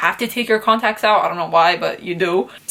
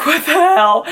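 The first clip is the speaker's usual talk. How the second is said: higher-pitched incredulous outburst; mock shock; 6 semitones higher, 4 dB louder than usual. Patient finding she lost weight, mock shocked. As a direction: act out a startled reaction.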